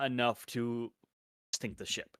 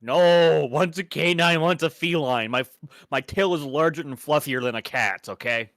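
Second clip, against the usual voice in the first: loud indignant correction; 6 semitones higher, 13 dB louder than usual. Real, not acted. Indignant fox correcting partner.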